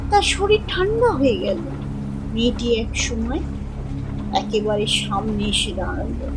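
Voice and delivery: ordinary delivery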